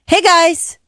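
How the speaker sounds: put-on mom calling the kids. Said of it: "I wasn't a yeller until I became a parent".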